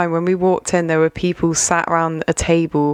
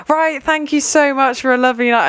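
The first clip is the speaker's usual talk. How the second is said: fake polite goodbye; 8 semitones higher than usual. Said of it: "I didn't wanna act like".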